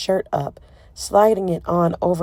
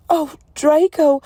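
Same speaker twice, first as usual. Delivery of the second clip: loud, high horrified cry of his name; shocked cry; 9 semitones higher, 8 dB louder than usual. A narrator's reading. Hermione seeing his bleeding wounds, horrified cry. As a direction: gasp it out in horror.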